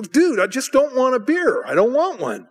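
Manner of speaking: high-pitched mock exasperation; exasperated refusal. Guest turning down pushy hosts, exasperated. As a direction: act out being fed up with nagging. Acts out refusing under pressure.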